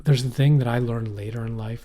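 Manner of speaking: normal talking voice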